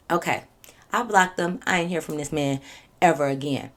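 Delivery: ordinary talk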